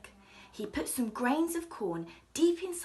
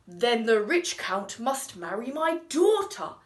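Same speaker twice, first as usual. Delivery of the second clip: grand king; 8 dB louder than usual. Acted. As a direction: boom it out like a king. King decides.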